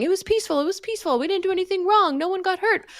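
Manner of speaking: high-pitched mock protest of innocence; imitating defensive excuses, pitched well up; mimicking deniers. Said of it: "hear them saying".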